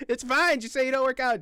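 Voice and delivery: loud, high call-out; exasperated shout. Real, not acted. Shouting at the streamer to just admit he doesn't work out. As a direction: yell it right at the screen.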